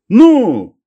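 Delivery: high, loud cry of delight. A narrator's reading. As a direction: burst out, overjoyed at the news. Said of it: "обрадовался дед". (громко и радостно)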